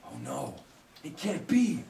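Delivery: usual speaking voice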